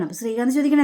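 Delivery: ordinary talk, nothing marked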